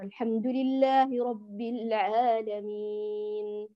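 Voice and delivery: slow chanted recitation, steady lengthening; slow tajwid chant of the opening verse; Quran recitation. Shown as a demonstration. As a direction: recite slowly with even madd. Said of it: "لو أنت قرأتي من أول السورة".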